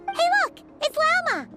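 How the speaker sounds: high, delighted call on spotting a friend; eager, high-pitched shout-out without much force; excited call. Acted.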